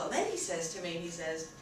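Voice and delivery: ordinary talk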